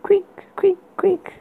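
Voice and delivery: high breathy creak whispered. A narrator's reading. Creaking sound effect voiced.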